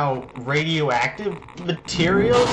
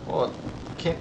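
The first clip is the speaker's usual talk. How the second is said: usual speaking voice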